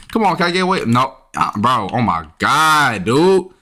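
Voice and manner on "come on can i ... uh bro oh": ordinary talk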